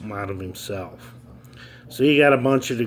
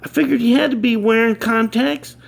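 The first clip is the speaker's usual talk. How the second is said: put-on much higher, louder boy's punchline; kid voice; 13 semitones higher, 5 dB louder than usual. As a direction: hit the punchline in the kid's voice.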